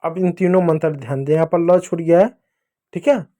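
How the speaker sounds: ordinary talk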